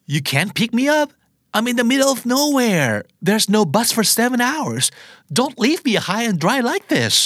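high-pitched, drawn-out plea of someone stranded; distressed pleading. Acted. Stranded caller begs a friend for a pickup, distressed pleading. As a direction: plead helplessly, voice pitched right up.

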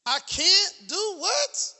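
slow, drawn-out disbelieving question; incredulous. Acted. Preacher mocking the excuse, slow and incredulous. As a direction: stretch it out like you can't believe it.

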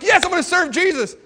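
high, bright burst of resolve; eager vow. Retold or imitated. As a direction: blurt it out all fired up. Eager vow after the night.

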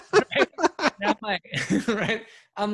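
talking through loud high laughter; he can barely get the words out for laughing. Real, not acted. Telling the podcast host the yoga story, laughing hard.

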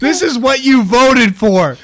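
loud, high, jeering; mock political taunt. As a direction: shout it like a campaign ad.

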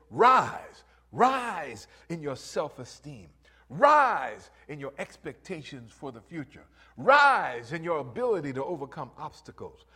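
loud, rising preacher call; preaching at full force. Acted. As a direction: build the crowd up with every "Rise". Building preaching climax.

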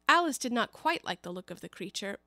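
ordinary delivery, nothing marked

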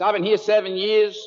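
high, loud put-on American accent; hammed-up mock American accent; imitated American accent. Scottish expat answering his friend, imitated American accent. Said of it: "Why are you speaking like that?".

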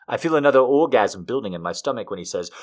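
ordinary delivery, nothing marked

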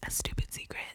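conspiratorial whisper. Pretend. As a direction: keep it hushed and secret. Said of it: "it's a secret".

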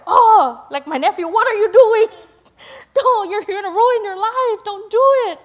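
high, loud worried plea; pleading concern. Retold or imitated. An aunt pleading with her nephew not to ruin his life, high and loud. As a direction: cry out to a nephew going astray. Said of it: "that feeling in our heart, like".

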